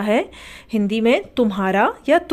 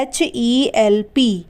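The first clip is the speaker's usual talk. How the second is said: slow spelling out; much slower than usual. Shown as a demonstration. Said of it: "इसे हम पढ़ते हैं help".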